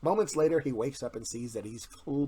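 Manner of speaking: ordinary delivery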